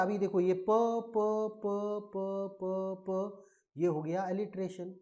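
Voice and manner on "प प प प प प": "प" chanted over and over, drawn out on one level pitch; repeated initial sound. Shown as a demonstration. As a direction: sound out "प" slowly in an even monotone series. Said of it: "अगर यही शुरुआत में आता".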